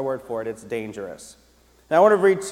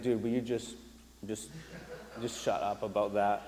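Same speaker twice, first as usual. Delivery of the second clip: hushed aside; 4 semitones lower, 9 dB quieter than usual. Retold or imitated. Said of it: "I wanna turn to Serenity and be like".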